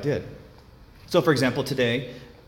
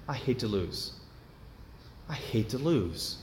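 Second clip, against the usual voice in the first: much softer, almost to himself; quiet repeat; 3 semitones lower, 9 dB quieter than usual. Real, not acted. Preacher repeating his flaw to himself, much softer.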